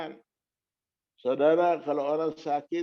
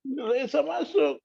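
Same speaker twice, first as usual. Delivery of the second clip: high mock cry of alarm; imitating distressed mother; 10 semitones higher, 4 dB louder, slower than usual. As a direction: call it out high, as the anxious mother. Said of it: "Nangis dia".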